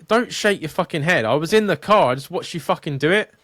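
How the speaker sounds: half-shouting again at the woman in the car; retold angry rant. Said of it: "And I was like".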